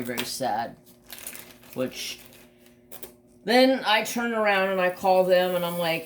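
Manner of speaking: ordinary talk, nothing marked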